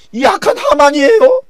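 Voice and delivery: very high, loud denunciation; queen denouncing Haman before the king; accusing cry. Acted. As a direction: cry out the villain's name.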